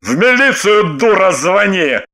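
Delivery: mildly angry man giving orders; ordering. A narrator's reading. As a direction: order her about as an irate husband. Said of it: "приказал". (приказным тоном, раздражённо)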